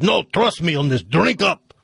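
put-on comic Russian joke accent; mixed-up Russian accent. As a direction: urge in hammed-up Russian. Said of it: "this Russian accent's gonna sound like".

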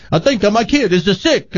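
high-pitched, hammed-up Italian accent; overdone bad movie Italian; Nick Nolte's Italian accent. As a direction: do a terrible mock Italian accent. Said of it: "when you hear Nick Nolte".

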